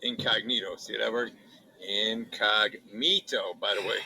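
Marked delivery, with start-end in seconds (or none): 1.9-3.5 drawn out. Shown as a demonstration. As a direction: stretch incognito out very slowly, syllable by syllable. Reading brand name off package.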